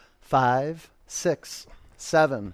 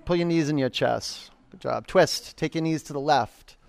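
ordinary delivery

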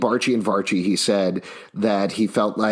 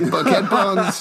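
laughing disbelief; 8 semitones higher, much slower than usual. Retold or imitated. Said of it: "And I was like".

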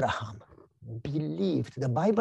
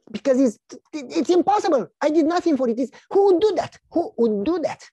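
high, loud, stammering disbelief; incredulous protest; 10 semitones higher, 6 dB louder than usual. Acted. Stunned receiver questions the giver of millions, incredulous. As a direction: burst out in acted disbelief, pitch soaring.

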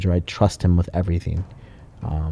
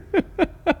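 high cackle; 6 dB quieter than usual. Real, not acted. Laughing at his own story.

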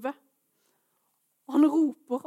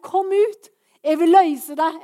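loud, higher-pitched command; calling out; 5 semitones higher, 11 dB louder, slower than usual. Acted. Preacher voicing Jesus calling listeners out of captivity, calling out. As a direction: cry it out as a summons to freedom. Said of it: "Han roper deg ut".

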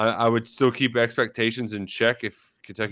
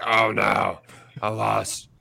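fake lost voice; 2 semitones lower than usual.

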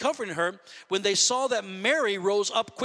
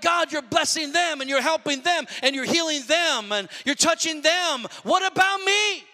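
mock grievance climbing higher to the end; offended, left-out complaint; 6 semitones higher than usual. Preacher voices believers envying others' blessings, offended complaint. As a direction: build an indignant plea to God. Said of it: "We feel like that".

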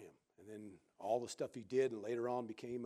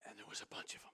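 soft unvoiced aside; whispered aside; 4 semitones lower, 8 dB quieter, much faster than usual. Real, not acted. Preacher whispering an aside about many rooms. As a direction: drop to a whisper.

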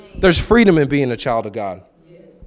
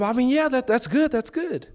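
awkward backpedal; 10 semitones higher, 5 dB quieter than usual. Retold or imitated. Backpedaling politely.

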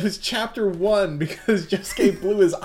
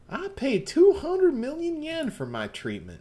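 Southern Belle; 10 semitones higher than usual. Acted. GM voicing Mitsuba to Yasuho as a sweet Southern belle. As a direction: play the genteel Southern lady, all honey. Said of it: "doing her Southern Belle".

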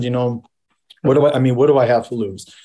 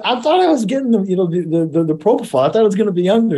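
mock dismay; 7 semitones higher, faster than usual. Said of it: "I said".

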